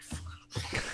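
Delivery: breathy, near-silent muttered "Fuck"; whispered curse. Retold or imitated. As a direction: curse under your breath in dismay. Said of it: "Loomis is like".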